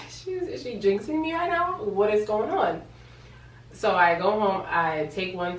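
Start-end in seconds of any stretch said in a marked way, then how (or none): 0.1-2.8 higher-pitched alarmed questioning; baffled, voice pitched up; bewildered inner protest. Retold or imitated. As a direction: wonder in mock panic what's happening. Alarmed thought at cashier's remark.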